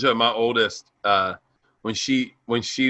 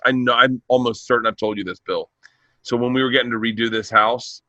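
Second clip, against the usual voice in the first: ordinary talk, nothing marked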